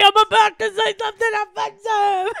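loud, high mocking comic voice; mock brash comic. Cohost mocking her partner's comic persona, loud. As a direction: parody the cohost's brash act. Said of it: "Hey, it's me".

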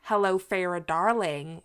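slow, badly faked American accent; terrible American accent. Shown as a demonstration. British host imagining how listeners read it, bad American accent. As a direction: have a clumsy go at an American accent. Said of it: "my terrible American accent".

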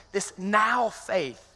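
"This now faith" stretched very slow, each word held; drawn-out emphasis. Real, not acted. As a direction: lean on "now faith" slowly. Preacher stretches scripture phrase.

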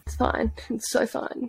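small, soft mock reassurance; unconvinced reassurance. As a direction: say it softly, as if convincing yourself.

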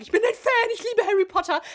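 high, loud fan gushing. Retold or imitated. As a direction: gush like a big fan. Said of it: "weil ich immer sage". (begeisterter Fan, hoch und laut)

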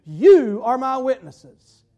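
YOU punched loud and stretched out; slow, pointed commission with a loud "You"; emphatic charge. Acted. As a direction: hit "You" hard, then drop away slowly. Jesus' words aimed at congregation.

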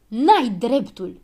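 loud, drawn-out objection; indignant storybook shout; shouted protest. A narrator's reading. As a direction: protest at full voice, stretching the words. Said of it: "strigă Habar N-am".

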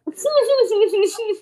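real laugh bursting out, high and loud; high squealing laugh. Real, not acted. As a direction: giggle helplessly, squeaking. Laughing at the elderly couple.

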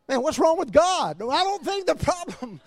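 high, worked-up comic protest; mock bewilderment. Preacher acting a confused believer, high mock bewilderment.

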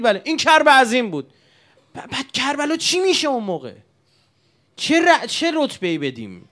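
high, loud, building sermon climax; impassioned preaching. Acted. Preacher to mourners, rising impassioned cry. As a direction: cry it out to the crowd.

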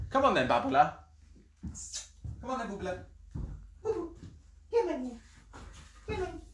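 high sing-song pet call. Real, not acted. Calling the cat to her.

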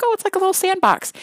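put-on high-pitched, quick child voice; younger kids. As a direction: imitate little kids taken with something. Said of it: "especially the younger kids".

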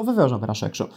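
mock-agreeable, low-key answer; springlike breezy tone. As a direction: act politely unbothered, dropping the pitch. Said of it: "με αυτό το ύφος φωνής και εγώ ανοιξιάτικος".

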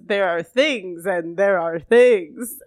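slow, ominous and loud storyteller; spooky voice. Acted. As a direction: draw it out like a spooky mystic. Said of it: "I'm just doing kind of a spooky voice".

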